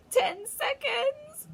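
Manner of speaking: high, slow gloating witch cry. A narrator's reading. Witch counting down to the crowd, high and gloating. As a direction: count down with cruel glee. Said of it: "cried the Grand High Witch".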